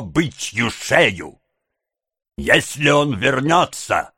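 drawn-out, heated threats; out of patience. A narrator's reading. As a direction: threaten deliberately, pitch climbing with fury. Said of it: "окончательно выведенный из терпения".

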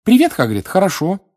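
put-on high, lifted girlish greeting; smiling reply. A narrator's reading. Girl greets Hagrid back at her table, smiling. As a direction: answer with a smile in a high, lifted voice. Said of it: "улыбнулась девочка".

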